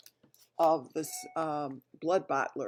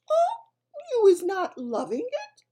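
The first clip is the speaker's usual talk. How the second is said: high, sweet mock innocence; innocently; 11 dB louder than usual. A narrator's reading. BFG teases Bloodbottler after the trick, high and innocent. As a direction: feign surprise sweetly. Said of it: "asked the B F G innocently".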